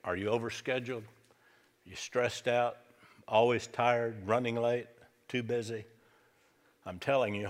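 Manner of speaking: ordinary delivery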